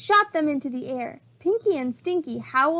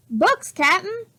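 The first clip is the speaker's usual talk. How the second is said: pirate calls down, higher and louder; shouting; 6 semitones higher, 4 dB louder than usual. A narrator's reading. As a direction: report back from the treehouse. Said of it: "Pinky shouted".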